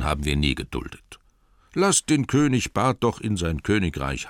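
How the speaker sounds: the Master cuts in, drawing himself up; Master's objection. Acted. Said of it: "der Bürgermeister".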